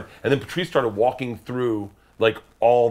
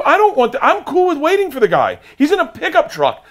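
raised plea; 7 dB louder than usual. Retold or imitated. Pleading with wife in car.

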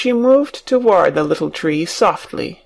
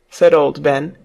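ordinary delivery

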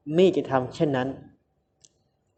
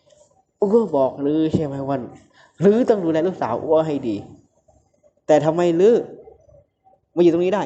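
raised, sharp scolding voice; loud angry scolding; 3 semitones higher than usual. A narrator's reading. Boss scolding his bodyguard, angrily loud. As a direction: berate him for losing the daughter. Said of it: "เสียงดังผัว".

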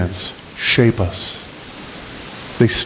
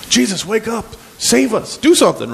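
high, urgent panicked plea; freaking out; 11 semitones higher than usual. Acted. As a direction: beg him to wake up, panicking. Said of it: "They're freaking out".

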